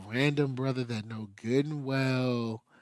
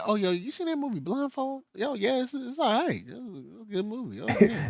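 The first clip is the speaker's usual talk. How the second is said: higher, quick goofy fan voice; high mock fan voice; 10 semitones higher, faster than usual. Friend mocks a clueless fan, high goofy voice. Voicing fans confusing the movie.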